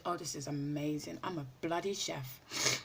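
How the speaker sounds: usual speaking voice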